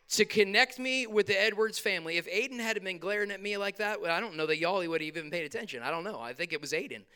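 ordinary talk

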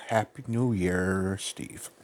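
slow, talking round a cigar; cigar in mouth. Shown as a demonstration. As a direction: mumble it past a cigar. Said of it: "With the cigar in your mouth".

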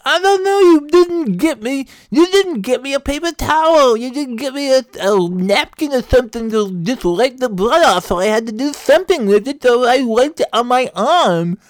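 very high, loud wail with tongue hanging out; tongue-out talk. Acted. Husband panicking to wife about his bleeding tongue, high tongue-out wail. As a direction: whine thickly around a stuck-out tongue. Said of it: "I just talked like that".

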